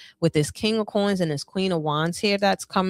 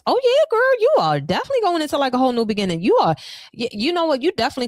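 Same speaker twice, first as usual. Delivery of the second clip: excited squeal; 9 semitones higher, much faster than usual. Real, not acted. Tarot reader gushing to her client over a great card, squealing with delight. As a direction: gush like a thrilled best friend. Reader thrilled by Nine of Coins.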